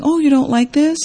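high mock-defensive question, rising at the end. Hearing herself answer her boyfriend back, defensive. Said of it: "I was aware that I was defensive".

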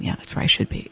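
a private aside under the breath; whispered thought. Retold or imitated. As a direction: whisper it to yourself, almost no voice.

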